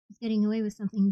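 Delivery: ordinary delivery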